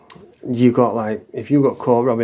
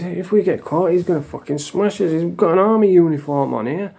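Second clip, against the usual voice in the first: retold panic, pitched higher; nervy, higher voice, reliving the fear; scared whisper-shout; 6 semitones higher than usual. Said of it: "I'm scared as shit".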